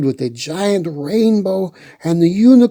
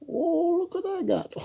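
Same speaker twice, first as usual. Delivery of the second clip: high, squeaky pretend voice; playful toy voice; 10 dB quieter than usual. Reader voicing a toy octopus, high and playful. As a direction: give the toy a tiny squeaky voice. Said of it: "shows toy octopuses".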